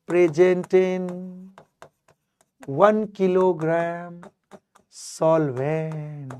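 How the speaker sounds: spelled out for notes; slow dictation. Shown as a demonstration. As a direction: dictate it slowly so they can write it down. Teacher dictates the definition.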